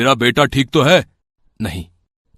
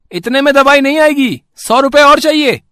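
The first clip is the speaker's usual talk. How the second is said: much higher, sneering shopkeeper voice; greedy shopkeeper; 14 semitones higher, 5 dB louder than usual. Acted. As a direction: look down on a poor customer, high and sneering. (लालची दुकानदार की बहुत ऊँची, तिरस्कार भरी आवाज़)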